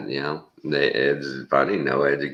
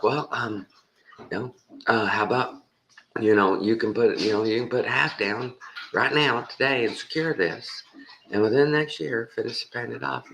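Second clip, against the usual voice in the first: high, coaxing payment offer; persuasive salesman; 9 semitones higher than usual. Retold or imitated. Salesman offering half down today, high and coaxing. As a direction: coax him into paying half down. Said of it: "he's like".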